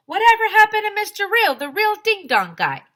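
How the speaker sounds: high, loud and fast; loud question. A narrator's reading. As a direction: call it out eagerly. Kid voice asks loudly.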